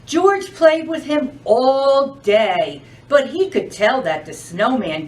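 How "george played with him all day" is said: plain reading voice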